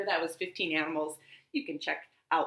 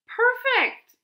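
delighted; 7 dB louder than usual. Acted. Bat finds a home.

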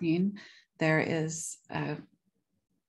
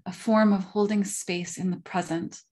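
measured lecture reading, phrase openings punched louder; reading a prepared talk; 5 dB louder than usual. Real, not acted. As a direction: read it out formally, leaning hard into each new sentence. Reads a prepared paper.

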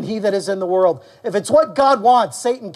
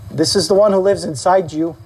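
ordinary talk, nothing marked

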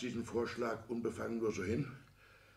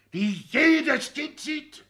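very high, loud, very slow; exasperated outcry; 13 semitones higher, 9 dB louder, much slower than usual. Acted. Father berating son for blindness, loud exasperated outcry. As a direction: hammer out each word.

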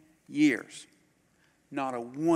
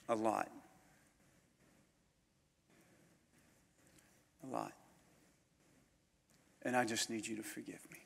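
much softer, near-hushed confession; 3 semitones lower, 10 dB quieter than usual. Retold or imitated. Preacher recalling asking forgiveness, very soft. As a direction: let it drop to almost nothing.

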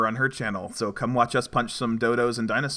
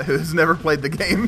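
ordinary talk

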